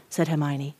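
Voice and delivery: usual speaking voice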